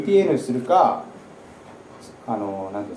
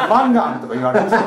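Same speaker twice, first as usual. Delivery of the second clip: ordinary delivery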